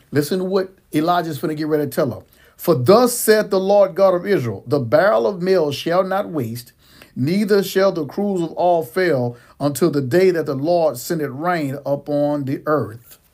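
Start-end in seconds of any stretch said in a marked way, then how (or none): 2.6-13.2 loud preacher reading King James; scripture reading. Acted. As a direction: proclaim the Bible passage. Reads scripture aloud.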